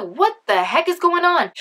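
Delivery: high-pitched exasperated question; acted-out disbelief; mock bewilderment. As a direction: throw it up high, baffled. Retold bewilderment at the smell.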